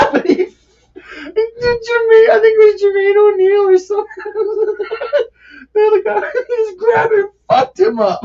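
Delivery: high, squealing through real laughter; trying to talk while cracking up; words squeezed out between laughs; helpless laughter. Real, not acted. Cracking up while recalling a brawl.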